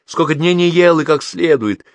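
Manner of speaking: high, loud, pressing question; insistent questioning. A narrator's reading. Aunt insistently repeats her question. (настойчивый громкий расспрос)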